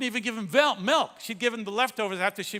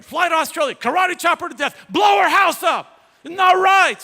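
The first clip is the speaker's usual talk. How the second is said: put-on kids egging daddy on, rising to a yell; indignant children demanding revenge for daddy; outraged children; 7 semitones higher, 10 dB louder than usual.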